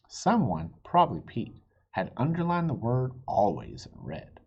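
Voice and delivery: ordinary delivery, nothing marked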